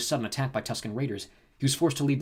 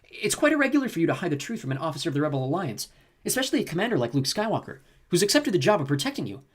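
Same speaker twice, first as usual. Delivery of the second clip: higher, fussy robot lecture; prim droid voice; 6 semitones higher than usual. Acted. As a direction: scold primly like a rule-bound droid. Chip lectures Ken.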